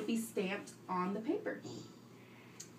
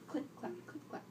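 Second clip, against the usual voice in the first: soft typing sounds; 6 semitones higher, 12 dB quieter, faster than usual. Shown as a demonstration. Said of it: "that's the sound he hears".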